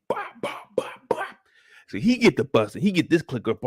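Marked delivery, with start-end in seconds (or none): 0.1-1.4 mock punch noises. Imitating hits.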